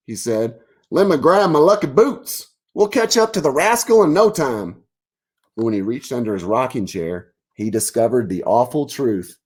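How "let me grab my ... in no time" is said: high, bold cartoon bison ready for action; rally for the chase; eager bison. Bison answers bravely.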